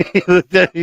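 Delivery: laughing through the words; can barely hold in the laugh, voice high. Real, not acted. Laughing while retelling the punchline.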